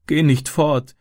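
put-on high, tearful child's plea; sobbing plea. A narrator's reading. Sister begs her brother not to leave, sobbing. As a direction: beg him through tears to stay. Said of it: "schluchzte sie".

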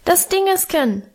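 put-on high, squeaky little boy voice; piping toddler. A narrator's reading. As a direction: pipe it like a tiny child. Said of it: "piepste Hermann".

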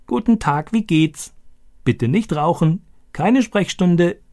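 high croaky parrot voice, each phrase squawked; croaking like the parrot. Acted. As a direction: imitate a talking parrot, croaking. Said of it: "Stani krächzte diese Sätze wie Boga". (wie ein krächzender Papagei, hoch)

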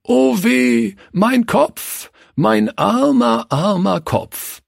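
high, slow hammed-up wail of pain; playing hurt on the floor, hammed-up lament; mock moaning. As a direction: groan it like a pretend victim. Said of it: "stöhnend und klagend".

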